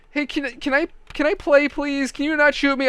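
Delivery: put-on high-pitched pleading little kid voice; begging kid. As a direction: beg like a nervous young boy. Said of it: "he gets back with us".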